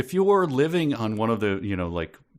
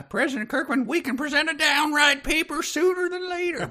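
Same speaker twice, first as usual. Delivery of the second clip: put-on much higher, old cowboy sidekick voice; Walter Brennan voice. Co-host reads the book's line as Walter Brennan, much higher cowboy sidekick voice. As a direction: read the line as the Western film's old sidekick. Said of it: "And he talks like this".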